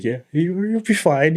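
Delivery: put-on high, lilting comforting of a child; reassuring dad. Dad soothing his hurt kid.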